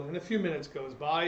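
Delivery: ordinary delivery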